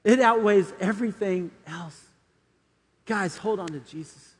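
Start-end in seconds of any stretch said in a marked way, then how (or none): 3.1-4.3 soft altar call. Acted. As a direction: gently invite them to pray. Closing prayer invitation over music.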